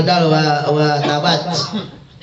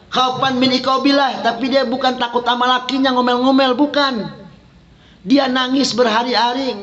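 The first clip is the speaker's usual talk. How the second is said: raised, fervent preacher voice; impassioned preaching; 8 semitones higher than usual. Acted. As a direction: preach it up high to the congregation. Ceramah dengan suara tinggi. (ceramah berapi-api dengan nada tinggi)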